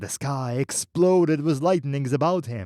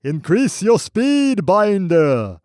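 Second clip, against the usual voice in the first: high, loud, slow urging; booming, slow command to speed up; thundering voice; 6 semitones higher, 7 dB louder, slower than usual. Acted. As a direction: drive him on like a storm. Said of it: "the voice thundered in his head".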